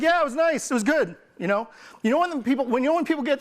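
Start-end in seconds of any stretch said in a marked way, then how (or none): 0.0-1.2 high, lukewarm "meh, it was fine"; shrugging customer. Retold or imitated. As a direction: play it like a customer who just shrugs it off. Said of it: "They're like".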